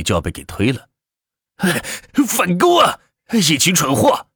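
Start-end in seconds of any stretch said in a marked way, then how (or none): 1.6-4.3 high-pitched exasperated shout at teammates; frantic, infuriated commander's cry; flustered angry yell. Acted. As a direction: yell orders at your useless team. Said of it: "张天浩气急败坏地喊道".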